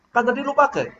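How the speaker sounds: high-pitched accusing retort. Shown as a demonstration. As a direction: throw it out as an accusation. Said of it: "Buat saya sih itu nuduh".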